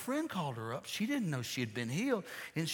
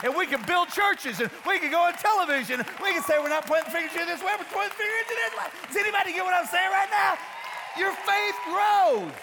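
full-force preaching; 11 semitones higher, 6 dB louder than usual. Real, not acted. Preacher rousing congregation about faith, shouting. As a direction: shout it to the congregation, rapid-fire then stretched.

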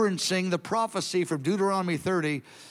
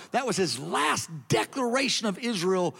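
normal talking voice

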